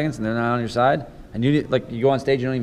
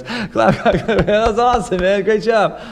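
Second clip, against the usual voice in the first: sarcastic clapper; 7 semitones higher, slower than usual. Pretend. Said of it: "He goes".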